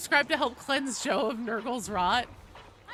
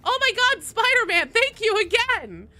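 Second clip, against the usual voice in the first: excited shout; 11 semitones higher, 8 dB louder than usual. Real, not acted. Streamer gushes thanks to Spider-Man again, excited. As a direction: gush thanks in delight. Another donation.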